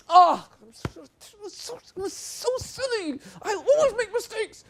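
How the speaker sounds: very high, squeaky hammed-up wail; mock self-blame. Teacher pretending to overreact to a mistake, high mock wail. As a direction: overdo the distress as a bad example. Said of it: "Do I go".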